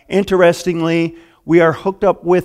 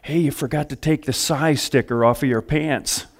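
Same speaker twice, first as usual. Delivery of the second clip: retold whisper; 7 dB quieter than usual. Friend tipping off the preacher in his pew, mock whisper. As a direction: quote a discreet word in the ear, much softer. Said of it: "he whispered in my ear".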